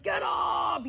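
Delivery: rowdy shout of get up. Acted. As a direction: bark it like a corner man. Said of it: "that's when I get rowdy".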